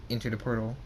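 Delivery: usual speaking voice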